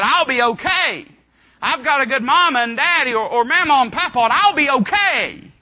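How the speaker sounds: loud, high-pitched protest of being exempt; cocky church kid. Acted. Church kid claiming the rules don't apply, loud and insistent. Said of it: "Sometimes church kids, they get the ideas".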